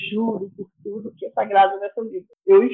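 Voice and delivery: ordinary delivery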